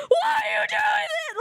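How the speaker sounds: high, loud, tearful cry breaking out; crying shout. Retold or imitated. Hurt child crying out at a parent who doesn't care. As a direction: scream it out through tears. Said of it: "that you're just like".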